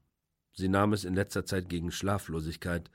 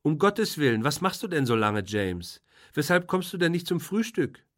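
a hint of a higher, fussy sister's voice; lightly voiced sister; 7 semitones higher, 5 dB louder than usual. A narrator's reading. Sister calls James to breakfast, lightly voiced and reproachful. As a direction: call him to breakfast, mildly reproachful.

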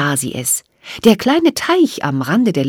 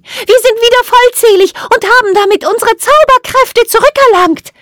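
very high, loud fairy voice; rally the troops in a tiny high voice; high-pitched, determined fairy shout; rallying call; 6 dB louder than usual. A narrator's reading. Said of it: "rief sie".

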